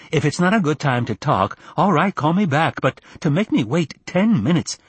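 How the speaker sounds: high-pitched, put-out mother on the phone; older mother's indignant complaint; aggrieved mother. Acted.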